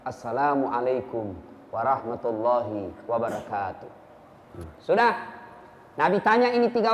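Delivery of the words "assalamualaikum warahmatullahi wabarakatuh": softer, lower, heartfelt greeting; sincere full salam. Shown as a demonstration. Preacher modelling a sincere salam for the congregation, soft and low. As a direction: offer the salam gently, meaning every word. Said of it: "menyempurnakan salam".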